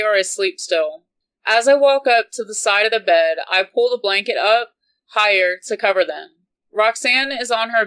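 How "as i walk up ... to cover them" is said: plain reading voice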